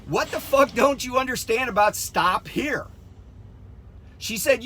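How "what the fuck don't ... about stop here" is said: higher-pitched, re-enacted angry demand; retold exasperated scolding. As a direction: berate the driver, retelling it.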